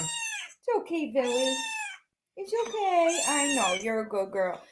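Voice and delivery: very high, soft cooing. Real, not acted. Owner cooing to her frightened cat, very high and soft.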